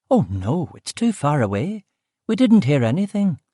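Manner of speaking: quick denial, Belfast lilt; Belfast cook. Acted. Cook telling the detective nothing was heard, in a Belfast accent. Cook answering about the killing.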